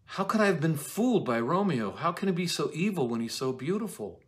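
high, pained disbelief; distraught Juliet. Acted. Juliet reeling at Romeo's betrayal, high and pained. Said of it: "Juliet is like".

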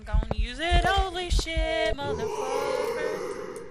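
very loud, high, slow screamed curse; terrified scream. Real, not acted. Streamer screaming as the ghost hunts her, terrified. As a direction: scream in pure terror.

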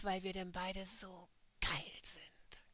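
slow conspiratorial whisper; slow sultry whisper; whispered confession. Acted. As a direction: lean in and whisper it slowly. Intimate confession.

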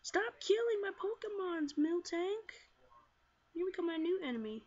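loud, high-pitched protest; exasperated scolding. Real, not acted. Player to Miltank killing the team, exasperated scolding. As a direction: tell off the enemy, loud and indignant.

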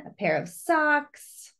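ordinary delivery

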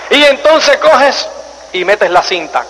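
ordinary delivery

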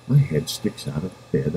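ordinary delivery, nothing marked

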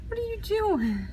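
high, playful pet voice; pet talk. Real, not acted. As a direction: coo at the kittens. Talking to kittens.

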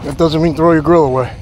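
normal talking voice

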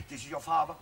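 quietly pulling parental rank; restrained stern father. Acted. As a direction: keep it soft and controlled.

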